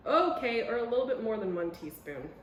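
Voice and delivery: ordinary talk, nothing marked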